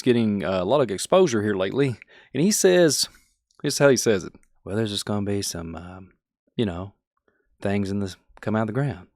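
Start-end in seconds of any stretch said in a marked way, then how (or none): 4.6-9.1 hushed, calm imitation of a soft-spoken guest; imitating soft-spoken man. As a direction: mimic his gentle, softer murmur. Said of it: "Very soft spoken individual".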